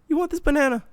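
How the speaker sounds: put-on high, sweet voice for a small child; high sing-song offer to the little girl.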